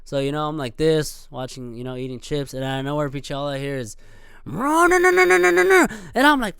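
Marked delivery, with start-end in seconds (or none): 4.4-6.6 a long, much higher, louder revving drone; imitated engine rev. Biker revving right beside him in traffic, high revving drone. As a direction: scream out a motorcycle revving past.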